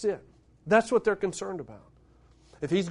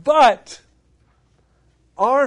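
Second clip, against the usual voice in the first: sudden loud; 4 semitones higher, 8 dB louder than usual. Real, not acted. Preacher building to climax.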